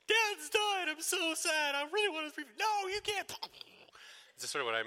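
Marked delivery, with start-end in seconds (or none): 0.1-3.3 put-on high squeaky kid voices, much louder; imagined sons squabbling. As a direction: play the boys fighting over Dad's briefcase. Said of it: "I just imagine my, my boys just".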